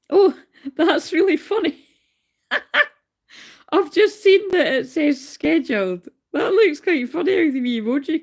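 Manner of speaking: high laugh spilling into the words. Real, not acted.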